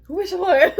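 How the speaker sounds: high, loud and excited; blurting it out to spot the guy; eager question. Retold or imitated. Q eagerly asking which guy.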